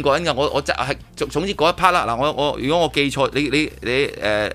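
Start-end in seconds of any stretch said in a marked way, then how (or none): none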